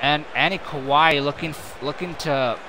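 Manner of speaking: brisk, running wrestling call; play-by-play commentary. Acted. Commentator calling the match for viewers, brisk play-by-play. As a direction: keep the action rolling like a ringside caller.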